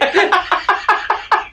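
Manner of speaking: high-pitched helpless cackle; high laugh. Real, not acted. Friend squeals laughing at the dark joke.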